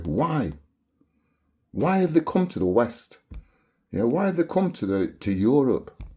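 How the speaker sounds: normal talking voice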